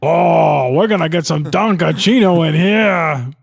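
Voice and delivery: bouncy over-the-top cartoon voice; hammed-up goofy delivery; exaggerated cartoon intonation. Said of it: "now I talk like a cartoon character".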